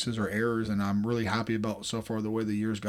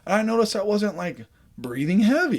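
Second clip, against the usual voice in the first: high, bright burst of relief; pumped-up self-quote; excited quote; 7 semitones higher, 4 dB louder than usual. Retold or imitated. Imitating his own relieved self.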